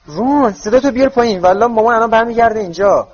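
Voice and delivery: urgent twin. Acted. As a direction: scold him to pipe down. Twin hushing Ron urgently.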